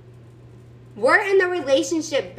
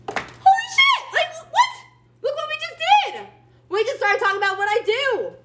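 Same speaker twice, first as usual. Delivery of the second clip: much higher, shouted mock astonishment; fake shock; 10 semitones higher than usual. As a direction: gasp it out like you can't believe your luck. Mock amazement at conversation trick.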